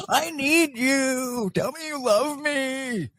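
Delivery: very high, drawn-out wail; hammed-up needy begging, stretched out slowly; desperate mock pleading. Mocks own pleading to women.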